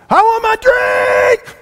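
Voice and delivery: put-on very high, shouted whiny child's wail; screaming child. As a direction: wail it like a toddler losing it in the car seat. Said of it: "It sounded something like".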